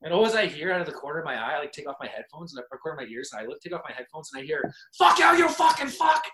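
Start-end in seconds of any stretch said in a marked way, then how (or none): 5.0-6.2 high furious shout; angry neighbor yelling insults; yelled curse. Retold or imitated. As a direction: scream it at the guy. Neighbor fight overheard, yelling.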